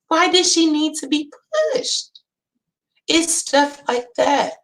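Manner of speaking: normal talking voice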